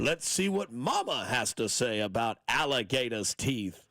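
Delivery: hammed-up Southern movie impression; mock Southern accent. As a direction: draw it out in a put-on Southern voice. Joins in the Southern Colonel impression.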